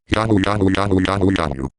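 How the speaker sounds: "Yahoo" run together with a tap after the Y; tapping glitch. Shown as a demonstration. Showing the finger tapping glitch, "Yahoo" repeated with taps. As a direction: repeat "Yahoo" back to back, tapping click inside each. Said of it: "a finger tapping sound after the Y".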